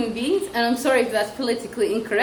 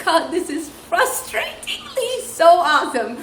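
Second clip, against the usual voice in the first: exasperated awe; 6 semitones higher, slower than usual. Retold or imitated. Teacher's thought watching slow child.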